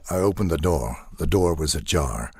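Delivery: a hint of radio drama detective; Philip Marlowe. Detective describes the visuals for radio drama, understated noir. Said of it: "back to the Philip Marlowe thing".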